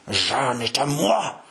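slow, drawn-out growl; growled inner triumph. Acted. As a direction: gloat in a fierce, slow growl. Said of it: "gronda-t-il au plus profond de lui-même". (grondement de joie furieuse)

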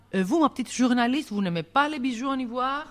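high, fast sugary villain voice; mock sweet teasing. Poacher coaxing the young journalist, high and quick. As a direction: wheedle the journalist in a sly high voice.

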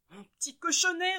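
a prim mother scandalized by her piglet; fussy mother voice. A narrator's reading. Mother sees dirty piglet, high fussy lament. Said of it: "reprend madame Propre".

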